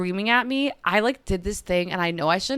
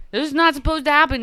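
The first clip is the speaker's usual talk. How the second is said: louder, higher burst with "not" stressed; "This is not supposed to happen" flung out louder, stress on "not"; emphatic repeat; 7 semitones higher, 6 dB louder than usual. Real, not acted. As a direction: repeat it with real frustration.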